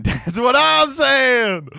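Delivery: high, loud and slow through laughter; laughing hard. Real, not acted. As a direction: squeal it out while cracking up. Laughing after a joke.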